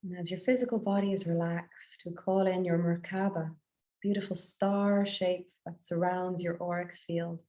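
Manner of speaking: guided meditation. Acted. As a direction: guide listeners gently inward, phrase by unhurried phrase.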